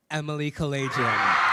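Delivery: loud call of Emily Kalajian's name; announcing the winner. Real, not acted. Announcer calls out the new president's name to the crowd, loud.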